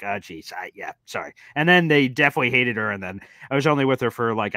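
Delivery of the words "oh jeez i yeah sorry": low, quiet embarrassed mumble; sheepish apology. Retold or imitated. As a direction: apologize under your breath. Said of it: "I was like".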